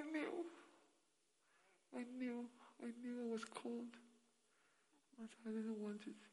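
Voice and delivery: high, weepy acted regret; tearful crying. Old person regretting the calling they refused, tearful and high. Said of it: "And you cry and say".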